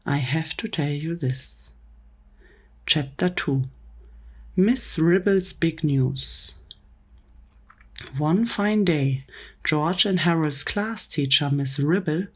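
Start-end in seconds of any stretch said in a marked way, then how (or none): none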